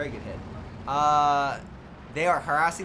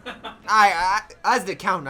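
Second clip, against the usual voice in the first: laughing; 6 dB louder than usual. Real, not acted. Laughing at own joke.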